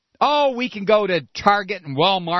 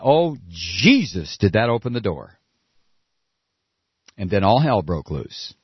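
ordinary talk

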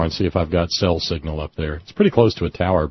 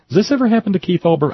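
exasperated higher, faster; 12 semitones higher, faster than usual. Real, not acted. Host venting to listeners about the messy show, higher and faster. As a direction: pitch jumps way up and rushes, mock-despairing.

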